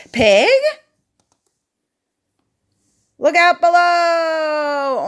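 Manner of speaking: loud, high, slow whooping call; excited yell. Acted. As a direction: shout it mid-leap with glee. Pig cannonballs into pond.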